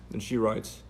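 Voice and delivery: normal talking voice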